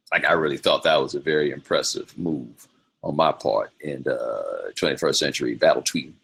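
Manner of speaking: ordinary talk, nothing marked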